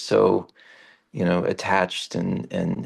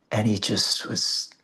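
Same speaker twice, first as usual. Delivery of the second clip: ordinary delivery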